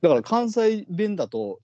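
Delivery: ordinary talk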